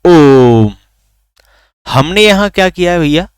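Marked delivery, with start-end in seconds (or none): 0.0-0.7 ओम with the ओ held long and loud; long, ringing ओ in ओम; drawn-out vowel. Shown as a demonstration. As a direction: draw out the ओ of ओम before closing on म. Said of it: "ओ का उच्चारण लंबा किया".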